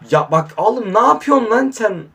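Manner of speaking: high, loud scold of the player; real irritated outburst; angry scolding. Real, not acted. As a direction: tell him off in frustration. Scolding the laggy game.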